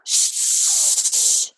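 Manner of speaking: long unvoiced shush; longer shush. Shown as a demonstration. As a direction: draw the shush out. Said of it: "give it a little bit of a longer shush".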